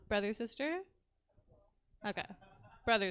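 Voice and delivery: ordinary talk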